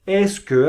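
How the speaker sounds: "Est-ce que" said as "es kø"; careful est-ce que. Shown as a demonstration. Teacher models "Est-ce que" for Spanish-speaking students. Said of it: "así se dice".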